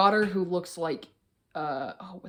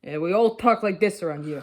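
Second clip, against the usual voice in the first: put-on Jersey accent. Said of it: "You do a Jersey accent".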